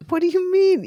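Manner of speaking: high-pitched disbelief; incredulous. Real, not acted. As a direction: blurt it in amazed disbelief. Shocked she doesn't know the superstition.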